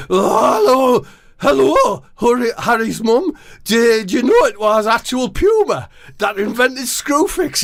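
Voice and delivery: chirpy high Scouse-ish footballer; high Jordan Henderson voice. Acted.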